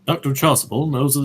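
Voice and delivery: normal talking voice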